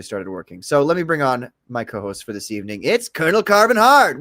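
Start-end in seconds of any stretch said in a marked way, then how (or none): 2.8-4.2 hype announcer intro. Acted. Introducing co-host on stream.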